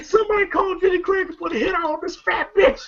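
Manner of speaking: high-pitched panicky imitation; mock cry for help. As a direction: call for help in a comic high voice.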